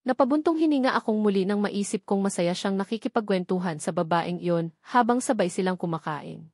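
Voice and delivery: ordinary delivery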